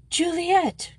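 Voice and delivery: shocked call of a name, high and sudden; startled cry. Acted. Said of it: "cried her head mistress".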